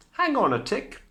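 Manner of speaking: high, loud fairy voice. A narrator's reading. Fairy godmother tells Cindy to wait. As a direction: chirp it like a fairy. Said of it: "The fairy said".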